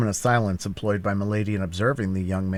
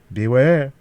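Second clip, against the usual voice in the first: loud, raised-pitch warning; threatening; 6 semitones higher, 9 dB louder than usual. A narrator's reading. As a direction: warn with menace. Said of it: "in a threatening accent".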